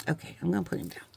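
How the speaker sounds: breathy whisper, half to herself. Real, not acted. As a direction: whisper it as an aside. Whispered aside while crafting.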